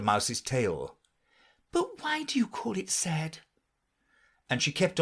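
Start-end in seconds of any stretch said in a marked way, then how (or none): 1.7-3.4 lightly voiced girl, puzzled; a hint of young Alice. A narrator's reading. Alice puzzling at the mouse, lightly girlish. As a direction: ask it with mild wonder.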